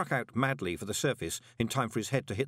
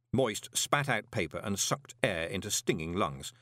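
plain reading voice

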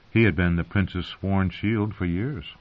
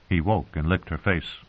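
usual speaking voice

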